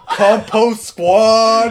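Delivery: mock rallying call. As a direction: call it out like a squad cry, stretched. Imitates friend calling out his catchphrase.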